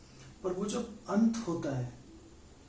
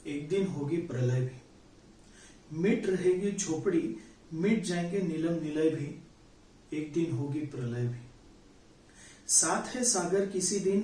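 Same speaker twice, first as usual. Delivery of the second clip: measured, solemn reading of a Hindi poem with lingering refrains; poem recitation; 3 dB louder than usual. Acted. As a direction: recite it like a stage poet.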